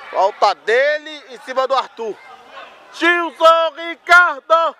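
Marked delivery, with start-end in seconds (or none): 2.9-4.8 drawn-out name call. Real, not acted.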